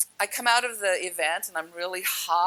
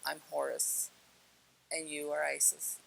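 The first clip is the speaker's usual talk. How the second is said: quiet reveal; 3 semitones lower, 9 dB quieter than usual. Retold or imitated. Retelling the boy's calm, solemn reveal.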